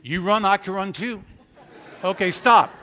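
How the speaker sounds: loud call across the room; loud and playful, calling across the church. Real, not acted. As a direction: shout after her as she walks out. Calling to Lauren walking out.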